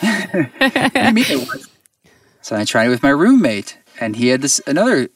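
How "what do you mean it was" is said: high, slow, amused incredulity through a laugh; laughing disbelief. Real, not acted. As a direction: laugh it out, baffled. Laughing disbelief at girlfriend's trip.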